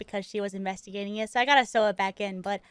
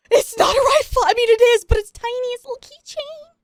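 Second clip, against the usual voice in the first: much higher and louder, pleading; mortified protest; 12 semitones higher, 9 dB louder than usual. Retold or imitated. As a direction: squeak out a frantic excuse. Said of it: "I was mortified".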